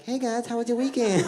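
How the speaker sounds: high [name] voice at the office; [name] impression. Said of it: "Michael comes in, like".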